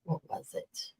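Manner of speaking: whispered to herself. Real, not acted. To herself, trying to recall what made her cry, whispering. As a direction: whisper it while searching memory. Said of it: "muttering while trying to remember".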